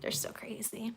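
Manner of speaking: unvoiced whisper; hushed aside, no voice. Real, not acted. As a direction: whisper it fondly to the viewers. Hushed aside about kittens.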